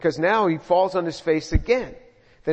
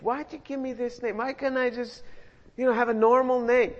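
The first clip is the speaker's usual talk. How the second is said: put-on whiny kid; 6 semitones higher than usual. Kids complaining about their names.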